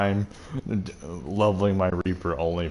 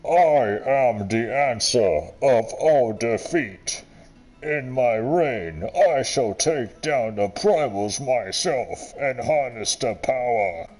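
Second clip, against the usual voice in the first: hammed-up tyrant speech; grandiose villain impression; 3 semitones higher, slower than usual. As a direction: declaim like a megalomaniac emperor. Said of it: "he was talking like this".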